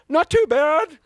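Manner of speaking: high, strained "Not too bad"; trying to sound casual while squeaking high; high squeaky. Shown as a demonstration. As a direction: squeak it out high like a voice about to crack. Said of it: "I said it like that".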